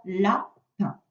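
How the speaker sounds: "lapin" in two slow, separate syllables; teacher sounding out "lapin"; split into syllables. Shown as a demonstration. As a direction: pause between the syllables of "lapin". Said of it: "est-ce qu'on l'entend dans lapin".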